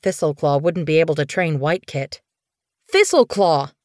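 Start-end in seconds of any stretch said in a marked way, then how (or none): 2.9-3.7 raised, higher call to interrupt. Acted. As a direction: call the name out sharply to stop him. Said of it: "she called".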